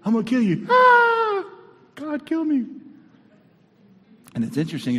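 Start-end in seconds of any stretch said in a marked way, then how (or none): 0.0-0.7 high, loud mock threat; threatening lady. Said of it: "One lady". 0.7-1.5 very high, loud terrified shriek; mock scream. Elijah terrified of one lady. 2.0-2.7 high-pitched, hammed-up frightened plea; mock panic. Acts Elijah panicking at the threat.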